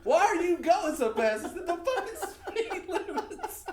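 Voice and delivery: very high, loud mock alarm; hammed-up argument. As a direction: shout over the road noise, half laughing. Acted shouting match in bus.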